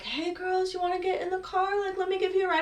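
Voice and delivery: high-pitched mock-friendly butler imitation; put-on high voice luring them over; creepy butler offer.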